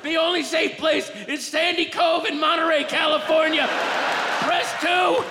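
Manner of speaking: high-pitched, frantic emergency announcement; hammed-up apocalyptic urgency; doomsday alarm. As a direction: sound the alarm for the end of the world. Acted doomsday emergency robocall.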